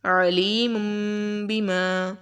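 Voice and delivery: chanted tajweed recitation with a nasal M; Quran recitation. Shown as a demonstration. Teacher recites the example for the students. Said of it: "اگلا دیکھیں".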